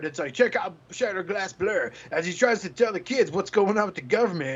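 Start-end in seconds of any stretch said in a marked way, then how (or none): 0.3-1.9 toy commercial voice. Shown as a demonstration. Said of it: "kids commercial".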